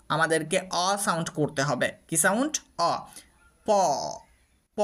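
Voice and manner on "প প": "প" with a drawn-out open o, r silent; long vowel. Shown as a demonstration. Teacher shows the silent-r syllable, প with long vowel. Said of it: "long sound হয়ে যাবে তখন".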